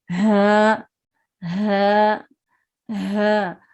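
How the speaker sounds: हा from the bottom of the throat, vibrating, held long; H from low throat. Shown as a demonstration. Teacher drilling after explaining the vibration, chesty long हा. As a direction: let हा resonate in the chest. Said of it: "वहां से ही हर्फ अदा होता है".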